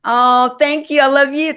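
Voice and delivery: touched thanks. Real, not acted. Touched by host's love.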